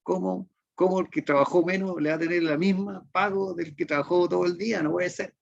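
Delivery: put-on high, protesting old lady voice; old grandmother. Preacher imitates his wife's old grandmother, high and indignant. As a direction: mimic an indignant elderly grandmother. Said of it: "ella, me acuerdo, decía".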